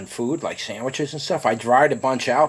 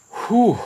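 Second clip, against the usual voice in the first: sharp exhale; 10 semitones higher, 3 dB louder than usual. Real, not acted. Gasp after hot pepper bite.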